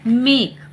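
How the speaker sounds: मेक with a held "ay" before k; long A sound. Shown as a demonstration. Teacher giving a third-rule example, मेक with long "ay". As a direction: stretch the vowel in मेक. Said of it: "vowel का long sound आता है".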